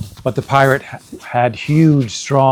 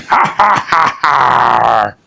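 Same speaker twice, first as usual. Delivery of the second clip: loud mocking pirate laughter; mean laugh; 4 dB louder, slower than usual. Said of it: "laughed meanly".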